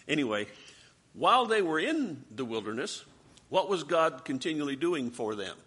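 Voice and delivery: ordinary talk, nothing marked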